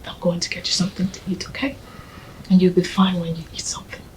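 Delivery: quick, gentle whisper. Acted. Friend urging the mourner to eat, gentle whisper. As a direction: coax her softly to eat.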